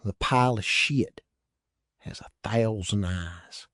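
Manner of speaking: deadpan it like a prophecy; gravely serious, falling quiet at the end. A narrator's reading. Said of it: "Teddy said gravely".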